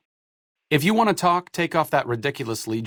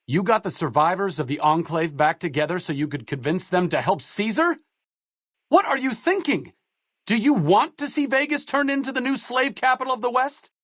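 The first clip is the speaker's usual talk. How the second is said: much higher, sharp scolding; angry disbelief; 10 semitones higher than usual. Acted. Companion berating the player for helping Caesar, angry disbelief. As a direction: tear into them for siding with Caesar.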